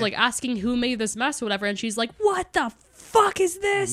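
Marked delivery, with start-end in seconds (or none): 2.2-3.9 high, drawn-out retold scream, without real volume; mock screaming mom. Mom demanding who made the mess, mock scream. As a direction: imitate a screaming parent in a high, slow pitch. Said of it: "full on screaming at these kids".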